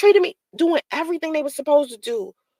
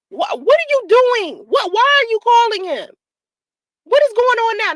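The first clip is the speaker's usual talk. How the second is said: higher, upset rapid questions; exasperated questioning; 7 semitones higher than usual. Retold or imitated. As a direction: demand answers, bewildered. Confronting the other woman.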